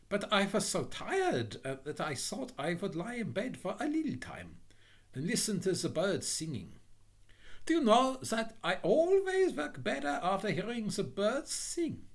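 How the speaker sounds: high-pitched innocent simpleton; meek, apologetic Little Hans. Acted. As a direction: explain yourself sweetly in a high little voice.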